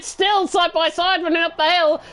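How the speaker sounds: animated motorsport commentary; excited live play-by-play. Real, not acted. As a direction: keep the tension up as the battle runs on.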